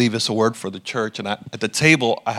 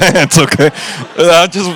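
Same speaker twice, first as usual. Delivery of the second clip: louder, laughing through the words, pitch up; 6 semitones higher, 8 dB louder than usual. Real, not acted. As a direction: crack up while confessing. Laughing at his own fear.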